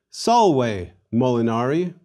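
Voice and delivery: salve molinari slowly as SAHL-way moh-lee-NAH-ree; model pronunciation. Shown as a demonstration. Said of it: "And again".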